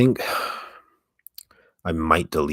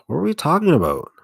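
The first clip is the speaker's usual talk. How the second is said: higher, louder puzzled question; confused; 12 semitones higher, 7 dB louder than usual. Real, not acted. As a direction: ask it baffled, pitch up. Forgets topic.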